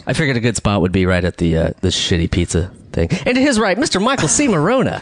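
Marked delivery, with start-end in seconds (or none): 3.3-5.0 high, ringing name announcement; show host introduction. Acted. Host presenting the man to his right, announcer style. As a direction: present the co-host with a big lifted voice.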